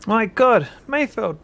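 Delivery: high, horrified shout. Acted. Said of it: "you shout".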